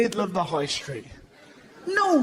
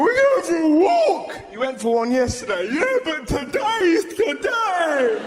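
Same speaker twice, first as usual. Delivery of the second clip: frantic excited dog; 9 semitones higher than usual. Acted. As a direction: shriek like a dog that can't contain itself. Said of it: "Dogs will lose their mind".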